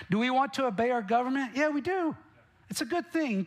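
preacher's playful high-pitched aside; rhetorical leap. Real, not acted. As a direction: toss the question up and answer it brightly. Rhetorical question to congregation.

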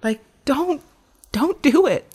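put-on high, loud childlike plea; a small child begging no; little girl crying out no; inner child pleading. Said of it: "some little child part of me that just felt like".

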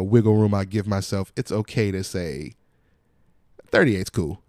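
ordinary talk, nothing marked

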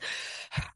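quick audible breaths out, almost hyperventilating; breathing out. Shown as a demonstration. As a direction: huff out a few breaths. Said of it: "I take some breaths".

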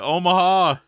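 a loud, barked-out audible at the line, quarterback style; Peyton audible call. Pretend. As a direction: bark Omaha across the huddle like a quarterback. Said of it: "call out some Peyton shit".

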